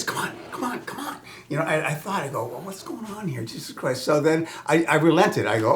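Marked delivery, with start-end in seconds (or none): 0.0-1.2 high, soft, breathy coaxing; beckoning call. Retold or imitated. Little imp beckoning the storyteller toward the lagoon, high and hushed. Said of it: "he was beckoning me like this". 2.5-4.0 hushed bewilderment. Retold or imitated. Bewildered inner thought.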